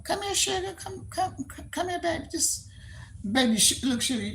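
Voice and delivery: high, soft coaxing sugar voice; a cooing high murmur of endearments; sweet-talking caller. Retold or imitated. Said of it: "somebody calling you sugar".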